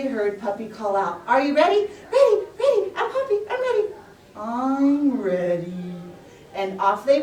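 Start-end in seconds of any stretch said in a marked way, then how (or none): 1.3-3.8 very high, loud, giggly puppy call; a puppy calling the race start; excited puppy. Acted. 4.4-5.9 very slow, drawn-out tortoise reply; the tortoise answering the puppy. Acted.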